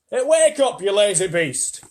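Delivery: high, loud wake-up shout; rousing call. Acted. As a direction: bellow at the sleeper. Centipede rouses the glowworm.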